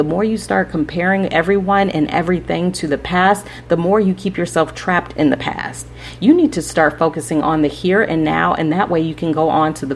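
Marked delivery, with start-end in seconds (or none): none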